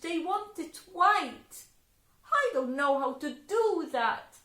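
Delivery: put-on high, loud girl's complaint; high, loud girl telling Mama her trouble; upset girl.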